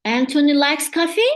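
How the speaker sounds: "Anthony likes coffee?" with a rise on "coffee"; questioning rise. Shown as a demonstration. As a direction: ask it as a question with rising tune at "coffee". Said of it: "instead of saying".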